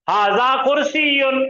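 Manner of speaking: हाजा कुर्सियुन stretched out slowly, vowels held long; हाजा कुर्सियुन drawn out syllable by syllable; drawn-out teaching model. Shown as a demonstration. Models phrase for class to repeat.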